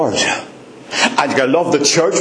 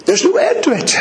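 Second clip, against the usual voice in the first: much higher, ringing cry; high ecstatic preacher's cry; soaring exclaim; faster than usual. Real, not acted. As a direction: lift it up in wonder.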